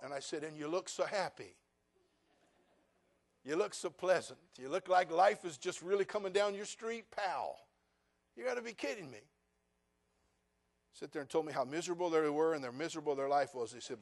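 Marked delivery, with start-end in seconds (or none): 0.4-9.2 sarcastic. Retold or imitated. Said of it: "Sarcasm is a way of life for me".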